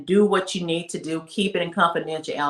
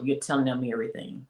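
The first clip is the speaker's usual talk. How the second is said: ordinary delivery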